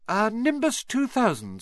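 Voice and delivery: high, suppressed amusement; boy's voice. Acted. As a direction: keep a straight face. Harry holding back laughter.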